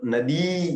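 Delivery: नदी with a stretched final ई; long final vowel. Shown as a demonstration. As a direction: hold the last vowel of नदी two beats. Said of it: "दीर्घ ई रखी". (नदी की ई दीर्घ, खींचकर)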